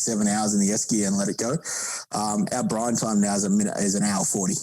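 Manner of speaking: usual speaking voice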